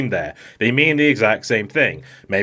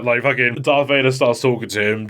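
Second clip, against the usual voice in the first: ordinary delivery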